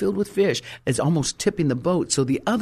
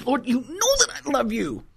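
much higher, wounded protest; hurt exasperation; 10 semitones higher than usual. Retold or imitated. Peter answering Jesus after the third ask, stung and exasperated. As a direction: push it back at him, stung and frustrated. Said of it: "Simon Peter got mad".

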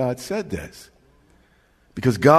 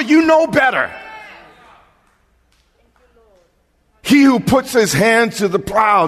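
high, loud, fast shouted preaching; fiery preacher shouting; preaching at full force; 10 semitones higher, 6 dB louder than usual. Acted. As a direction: hammer it home to the congregation. Preaching climax.

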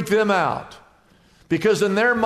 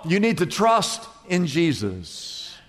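gentle answer; 3 semitones lower than usual. Acted. Pastor calmly answering a sleepless believer.

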